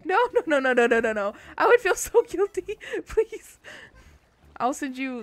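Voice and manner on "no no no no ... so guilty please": louder, higher pleading with laughter; laughing protest. Real, not acted. Streamer begging viewers not to donate, laughing. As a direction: laugh while begging them not to pay.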